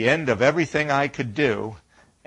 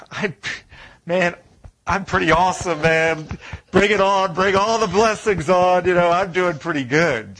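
higher, louder cocky brag; smug bragging; 5 semitones higher, 4 dB louder than usual. Pretend. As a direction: strut like a self-satisfied saint. Mocks smug believer bragging.

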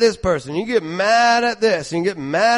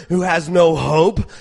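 normal talking voice

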